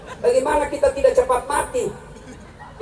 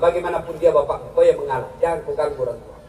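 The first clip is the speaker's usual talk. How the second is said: calm divine counsel; 8 semitones lower than usual. Retold or imitated. God answering Ibrahim, low and grave. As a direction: settle him gently and solemnly.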